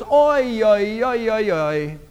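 a light oi oi lament, falling away; mild mock oi oi wail; Yiddish lament. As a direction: sigh it out like an old Yiddish wail. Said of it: "There is a Yiddish term that goes".

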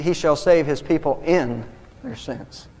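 slow, with "in" set apart by emphasis; stress on "in". Shown as a demonstration. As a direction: lean on "in", then drop away on "their sins". Contrasting the preposition with the verse.

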